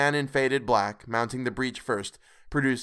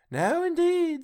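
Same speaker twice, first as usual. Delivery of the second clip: high, amused agreement; amused Missus Dilber; slower than usual. A narrator's reading. Missus Dilber agreeing about the dead man, mildly amused.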